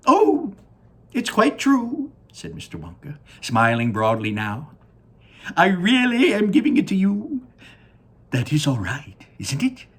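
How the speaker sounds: very high, loud delighted squeal; excited high Wonka. Acted. Wonka smiling broadly at Charlie, very high and excited. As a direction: beam and squeal with joy.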